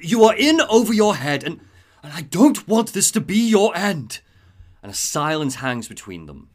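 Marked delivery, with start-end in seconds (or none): 0.0-4.2 louder, higher earnest plea, slowing at the end; Alfred's heartfelt plea. Retold or imitated. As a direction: beg him not to go. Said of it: "and then he goes".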